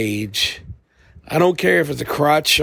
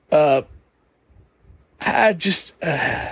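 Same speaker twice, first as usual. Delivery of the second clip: normal talking voice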